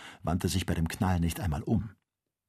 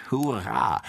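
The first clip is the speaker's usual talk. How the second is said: verhaltener Jubelruf; jubelnd; 3 semitones higher than usual. A narrator's reading. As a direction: juble mit einem Hauch von Freude. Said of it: "jubelte der Jäger".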